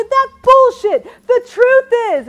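slow, high, chest-pounding bellow; drawn-out rallying yell; defiant shout. Shown as a demonstration. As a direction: declare it loudly with total defiance. Chest-pounding declaration demonstrated.

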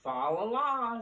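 ordinary delivery

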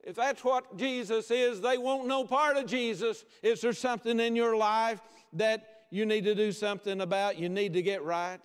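high-pitched, pressing admonishment; insistent preaching. Real, not acted. Preacher warning believers about their poor witness, insistent preaching. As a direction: press the rebuke on the congregation.

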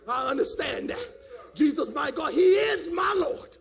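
insistent preaching with loud, high peaks; fervent preaching. Real, not acted. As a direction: testify forcefully to the congregation. Preaches at full force.

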